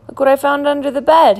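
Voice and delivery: high, loud, eager announcement; cheering up. A narrator's reading. Ernie tries to cheer up Bert, eager. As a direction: show off a find to lift a friend.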